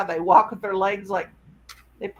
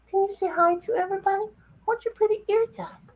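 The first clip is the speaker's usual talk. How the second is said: much higher coaxing to the dog; pet voice; 11 semitones higher than usual. Retold or imitated. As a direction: sweet-talk the dog. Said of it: "retold words to dog".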